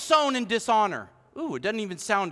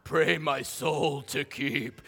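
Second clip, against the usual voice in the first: much lower, much softer, very slow eerie prayer; creepy prayer; 8 semitones lower, 5 dB quieter, much slower than usual. Pretend. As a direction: drag it out like a haunted bedtime prayer. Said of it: "put a creepy voice to that".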